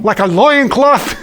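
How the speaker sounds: put-on "loin" as two drawn syllables in a high Brooklyn accent, slow; Brooklyn-accented "loin cloth"; mock Brooklyn accent. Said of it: "like a Brooklyn".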